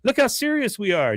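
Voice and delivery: high mock girl voice; imitated high-pitched niece; earnest niece. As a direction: protest up high, eager and earnest. Niece defends her protest.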